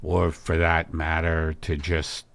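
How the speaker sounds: a low, earnest worried husband; Al's low, concerned voice. Acted. Husband voicing concern about wife's emptiness, low and grave. Al gentle.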